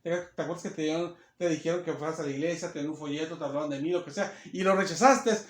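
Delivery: low, soft voice of God, quickly reminding; God's reproachful reply. Acted. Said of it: "Y Dios le dice". (voz de Dios, baja y suave, recordando)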